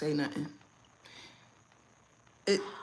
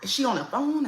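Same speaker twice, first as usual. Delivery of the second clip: feigned confusion; 7 semitones higher, 4 dB louder than usual. Shown as a demonstration. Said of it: "You can also do this".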